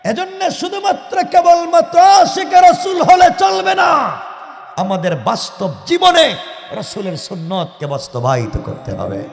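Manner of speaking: ordinary talk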